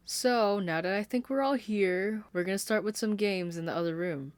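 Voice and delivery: high, loud bubbly hostess announcement; sweet sing-songy. Acted. Caitlin announcing games to the crowd, sweet sing-song. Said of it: "the sweet, sing-songy way".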